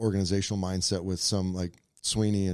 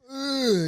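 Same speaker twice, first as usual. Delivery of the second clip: mock exasperated groan. Groan in city rush story.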